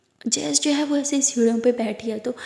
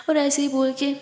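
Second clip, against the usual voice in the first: ordinary delivery, nothing marked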